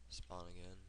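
usual speaking voice

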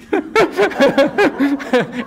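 high long laughter; amused laugh at the memory. Real, not acted. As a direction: laugh hard. Laughs at mom's line.

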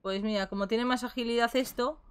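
usual speaking voice

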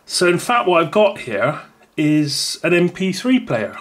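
ordinary delivery

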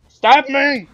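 loud, high shout; excited gamer yell; calling out. Real, not acted. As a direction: shout it to a teammate. Calling out to teammate mid-game.

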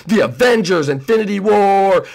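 ordinary delivery

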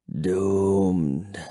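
low, soft, drawn-out vampire voice; hushed undead gloom; whispering vampire. A narrator's reading. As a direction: breathe out despair, deep and hushed. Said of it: "he whispered".

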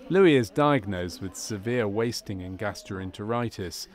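ordinary talk

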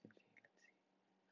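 whispered to self; focused whisper. Real, not acted. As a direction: breathe it in a whisper. Whispering while playing.

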